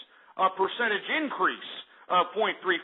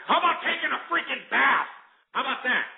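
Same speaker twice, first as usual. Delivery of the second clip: scornful jab, pitch way up; mocking jab; 7 semitones higher than usual. Real, not acted. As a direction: taunt them with a high-pitched sneer. Mocking the French heat deaths.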